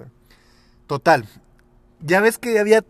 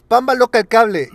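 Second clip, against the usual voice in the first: loud high game call; shouting; 9 semitones higher, 10 dB louder than usual. Retold or imitated. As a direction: yell it like a schoolkid.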